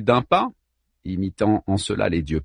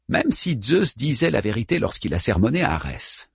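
usual speaking voice

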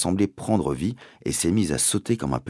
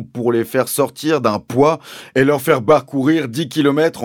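higher, loud, pompous sergeant; police sergeant swapping P and B; 8 semitones higher, 8 dB louder than usual. A narrator's reading. Sergeant questioning the landowner's accusation, pompous, P and B swapped. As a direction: pronounce every P as B, with official ponderousness. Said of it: "remplacer les P par des B".

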